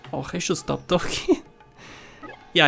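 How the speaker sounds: normal talking voice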